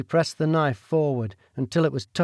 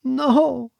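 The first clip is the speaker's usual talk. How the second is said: a tearful, high-pitched no; sobbing refusal; 10 semitones higher than usual. Acted. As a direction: choke out the refusal through tears. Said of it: "she sobbed".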